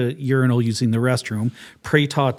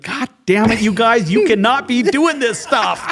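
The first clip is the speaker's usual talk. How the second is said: higher exasperated scold, laughing through it; mock exasperated chief; 9 semitones higher than usual. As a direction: scold the boys, half-laughing. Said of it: "He's like".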